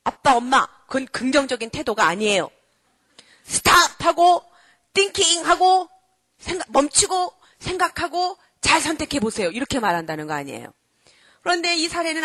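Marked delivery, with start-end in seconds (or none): 0.0-9.5 emphatic little lecturer, phrase by phrase; bold child. Acted. Child stepping in as parents fight, boldly admonishing. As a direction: act out a child firmly instructing the parents. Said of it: "무서워서 벌벌 떴던 애가 탁 들어서".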